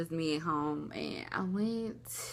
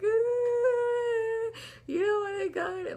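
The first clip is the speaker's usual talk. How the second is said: high-pitched, exaggerated wail imitating herself crying; mock hysterical bawling; 3 dB louder, slower than usual. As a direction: bawl it theatrically, as if someone killed your cat. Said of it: "hysterically, bawling, like someone had just killed my cat".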